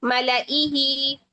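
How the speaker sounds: मलाइही slowly, no long ya; drawn out मलाइही with short hi; Qur'anic recitation. Shown as a demonstration. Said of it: "इस तरह पढ़ा जा रहा है".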